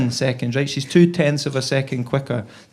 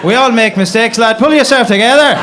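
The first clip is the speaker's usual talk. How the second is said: raised, higher voice; loud driver's telling-off; 8 semitones higher, 7 dB louder than usual. Acted. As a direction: scold him loudly as the driver. Driver scolding crying passenger, retold.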